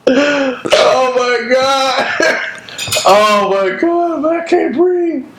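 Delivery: high, slow, breathless from laughing; after laughing hard. Real, not acted. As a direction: gasp words out after laughing. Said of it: "you laughing a little too hard".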